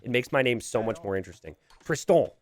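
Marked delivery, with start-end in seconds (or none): 1.8-2.3 TRISTAN said the French way, nasal end stressed; French-style Tristan; French pronunciation. Shown as a demonstration. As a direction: say Tristan as a French name, tris-TON. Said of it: "favorite French words to say is actually my name".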